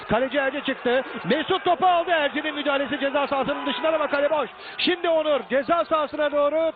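high, fast empty-goal call; screaming football commentator. Real, not acted. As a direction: rush the call as the keeper is out.

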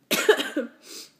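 loud, real cough from a cold; a hard chesty cough. Real, not acted. Sick vlogger coughing between lines, a loud real cough. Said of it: "I have a really bad cold".

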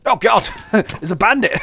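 loud, high cry of alarm; laughing panic, high and loud; startled yell. Real, not acted. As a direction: yell out as you spot an attacker. Startled by bandit attack in game.

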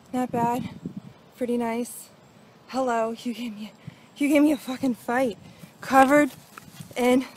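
ordinary delivery

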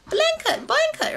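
put-on high little-child voice calling blanket; squeaky toddler repeating blanket; imitating an eighteen-month-old.

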